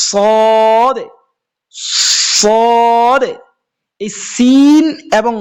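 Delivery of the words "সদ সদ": "সদ" said with a heavy, thick s; tajweed demo of "সদ"; emphatic sad. Shown as a demonstration. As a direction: lean hard into the "সদ". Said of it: "যেমন দেখেন".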